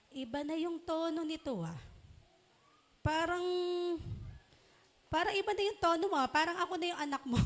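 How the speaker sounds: quick, rising nagging parent's complaint; annoyed parent scolding. Retold or imitated. A parent scolding a child for talking back, fast and high. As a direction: rattle it off like a fed-up parent.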